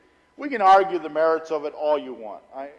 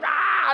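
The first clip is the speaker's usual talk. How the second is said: frustrated exclamation; 11 semitones higher, 4 dB louder than usual. Retold or imitated. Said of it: "wanted to turn around and go".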